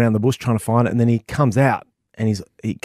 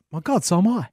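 higher-pitched mock astonishment; amazed reply; 9 semitones higher than usual. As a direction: act pleasantly amazed at the coincidence. Amazed reply in acted joke.

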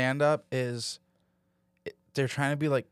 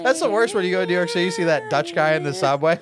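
mock outrage; 5 semitones higher, 7 dB louder, faster than usual. Mock outrage about Dutch subway busker.

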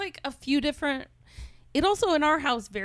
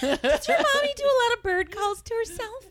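laughing mock question; 11 semitones higher than usual.

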